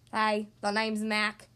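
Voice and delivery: slow, low, monotone creature; slobbery monster. A narrator's reading. Fourth slobbering monster greets the child, low and slow. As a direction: introduce yourself low and slow.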